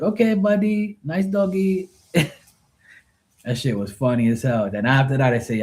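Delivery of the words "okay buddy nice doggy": put-on high, nervous, Filipino-accented voice; imitating scared pool guy. Scared pool cleaner calming the dog, in a high accented voice. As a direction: soothe the dog while terrified. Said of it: "And he's like".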